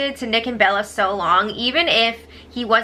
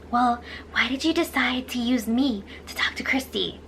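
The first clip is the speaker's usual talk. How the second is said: half-whispered retold question; hushed quote; 6 dB quieter than usual. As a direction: quote her under your breath, barely voiced. Quotes Sis confronting Nick, hushed.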